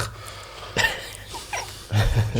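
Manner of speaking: breathy high laugh; burst of laughter. Real, not acted. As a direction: laugh out at the joke. Laughs at own punchline.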